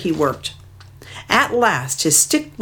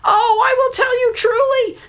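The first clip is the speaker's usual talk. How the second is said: much higher, louder frightened cry; crying in fright; 4 dB louder than usual. Acted. Mud hen about to be killed, frightened high cry. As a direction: cry out like a terrified small bird. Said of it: "she cried in fright".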